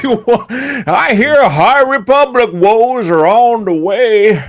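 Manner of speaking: high, very slow hammy voice; theme park role player. Acted. Park actor warning guests of trouble coming, slow hammy voice. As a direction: draw out the woes with overdone drama. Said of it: "now everyone's like".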